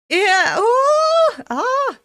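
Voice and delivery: high, loud wailing "ohh"; shocked scream. Real, not acted. Viewer watching the devil attack, shocked high scream. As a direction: cry out high at the gore.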